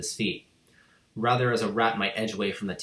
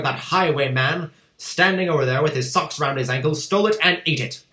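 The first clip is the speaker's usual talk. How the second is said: loud, higher, indignant tirade; furious rant; 6 semitones higher, 6 dB louder than usual. A narrator's reading. Headmistress accusing the boy of stealing cake, furious. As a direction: rage over the stolen cake.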